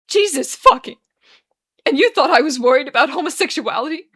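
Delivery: loud, high tearful outburst; weeping. A narrator's reading. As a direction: cry out in anguished disbelief. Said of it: "Arthur weeps".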